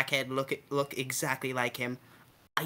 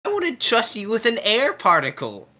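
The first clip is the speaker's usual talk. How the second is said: loud jab; 9 semitones higher, 9 dB louder than usual. Real, not acted. Player mocking nurse, loudly.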